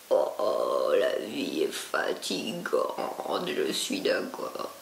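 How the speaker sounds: low whispered weary cat; sleepy cat voice. Acted. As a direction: sigh as the lazy cat, whispered and slow.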